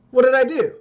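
high, loud, defensive squawk; startled Bobby. Acted. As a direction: play him caught off guard.

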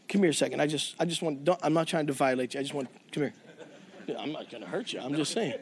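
a low, quiet aside to a stranger; hushed plea. Retold or imitated. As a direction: keep it hushed so nobody overhears. Worker quietly pulls pastor aside.

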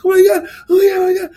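high, loud joyful yelling; ecstatic shout. Retold or imitated. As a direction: scream it with pure joy. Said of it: "at the top of my lungs".

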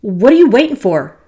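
sharp, loud impatient outburst; snapped. Acted. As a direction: snap at him to get moving. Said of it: "Then she snapped".

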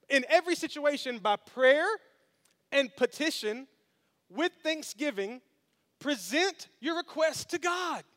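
high, slow, ringing proclamation; emphatic scripture quote. Acted. Pastor proclaims Paul's words to the congregation, slow and lifted. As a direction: proclaim each phrase deliberately.

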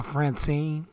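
ordinary delivery

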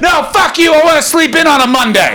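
high, loud mock yell; hammed-up outrage. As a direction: shout it like an indignant crowd. Outraged public answering mayor, acted out.

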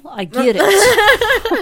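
high, slow words stretched through a laugh. Real, not acted. To the hosts after her own joke, laughing hard.

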